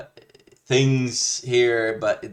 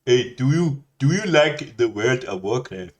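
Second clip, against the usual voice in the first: put-on Indian accent; 3 semitones higher, 4 dB louder than usual. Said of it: "talk to Hamutna Smableble in India".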